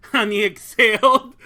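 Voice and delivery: usual speaking voice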